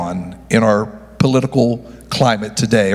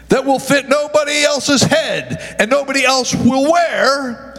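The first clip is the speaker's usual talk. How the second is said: high, sustained preacher's cadence, half sung; fervent preaching; 9 semitones higher than usual. Acted. Pastor to his congregation about heaven's throne, fervent sung preaching. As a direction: lift the congregation with a singing, rising preach.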